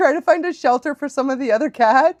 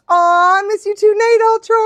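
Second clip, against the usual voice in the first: affectionate farewell; 11 semitones higher, 5 dB louder than usual. Real, not acted. Streamer saying goodnight to viewer, gushing affection. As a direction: call out sweetly to someone leaving. Calls goodbye to a departing viewer.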